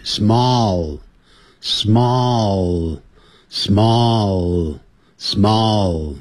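"small" slowly drawn out, tongue tip up on the final L; clear final L. Shown as a demonstration. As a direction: model "small" slowly, ending on a held L. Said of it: "所以舌尖要抵上去". (慢速示范 small，舌尖抵上去发出结尾的 L)

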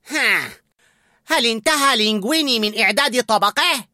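high, loud, avid question; eager. A narrator's reading. Head chef to sous-chef, eager asking. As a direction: ask as if bursting for news. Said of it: "وسأله متلهفا".

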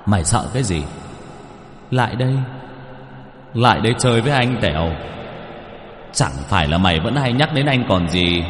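soft, coaxing ghost voice; soft luring ghost. Acted. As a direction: lure the boy closer. Hồn ma dụ Tèo lại gần. (giọng ma dụ dỗ khe khẽ)

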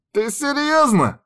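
high, slow squeaky disbelief; indignant rat voice. A narrator's reading. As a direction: squeak it out in outrage. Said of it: "возмущённый голос крыса".

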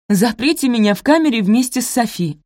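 high, loud melodramatic plea; theatrical exclamation. A narrator's reading. Posy begging to be jailed with Sophie, theatrically exclaiming. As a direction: declaim it for maximum effect. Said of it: "театрально воскликнула Пози, для пущего эффекта".

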